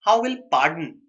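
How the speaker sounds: ordinary talk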